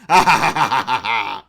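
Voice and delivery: loud gloating villain cackle; evil laugh. Acted. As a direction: cackle at your own triumph. Villain laughs after his threat.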